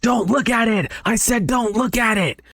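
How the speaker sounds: very high-pitched frantic cry; yelling. Acted. Possessed girl turns on her friend, yelling. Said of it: "In Young just yells".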